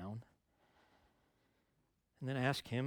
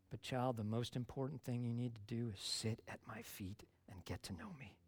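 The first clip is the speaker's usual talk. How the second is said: tender whisper; 4 dB quieter than usual. Acted. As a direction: breathe it gently like a comfort. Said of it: "And Jesus says".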